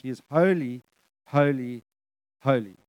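each HOLY set apart by a pause, falling; unrushed, spaced repetition. Real, not acted. Preacher stopping the church to dwell on scripture, unrushed. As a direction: let every "holy" land alone, stepping down. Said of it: "I'm not gonna just rush".

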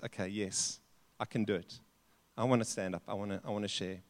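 quietly working up courage to agree; reluctant quiet yes. Retold or imitated. Elder finally agreeing, reluctant.

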